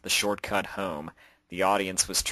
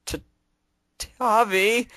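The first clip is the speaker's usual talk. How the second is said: high, mortified stammer; stuttering; 8 dB louder, slower than usual. A narrator's reading. Cobalt calling to Octavia, stuttering and mortified. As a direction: stammer out the name in embarrassment. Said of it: "stuttered the mortified stallion".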